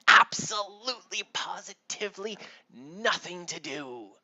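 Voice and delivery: high, loud, theatrical insistence; emphatic sister. Acted. Sarah answers her father, breezily emphatic. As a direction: punch each big word high, easing down at the end.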